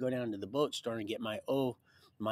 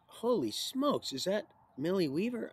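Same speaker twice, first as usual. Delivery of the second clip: amazed exclamation; 7 semitones higher than usual. Retold or imitated. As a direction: blurt it out in wonder, pitch jumping. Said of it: "I looked at her, I went".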